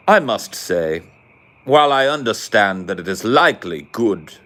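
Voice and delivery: mock British stuffy complaint; put-on British accent, primly aggrieved; salty Brit. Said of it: "salty Brits who were".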